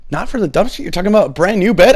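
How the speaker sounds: high, fast disbelieving question; can't believe it's free; incredulous disbelief. Retold or imitated. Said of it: "I was like".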